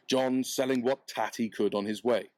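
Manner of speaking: ordinary delivery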